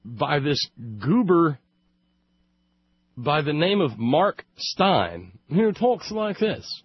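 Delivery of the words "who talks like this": pitched way up, hammed-up phony British accent; mock British accent. Host mocking a radio sub, phony British accent. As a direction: send up a fake British talk host. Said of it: "With a British accent is about as phony".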